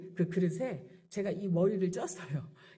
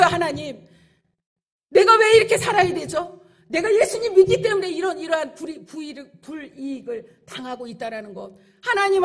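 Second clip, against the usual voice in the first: loud, higher pleading complaint to God; anguished outcry; 7 semitones higher, 12 dB louder than usual. Acted. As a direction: cry out why me, pleading and protesting. Acted complaining prayer to God. (하나님께 억울함을 토로하는 큰 외침)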